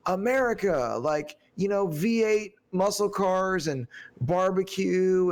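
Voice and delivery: high, stretched-out proud listing; mock enthusiastic. Man lists what feels American, mock enthusiastic. Acting out his list of American things.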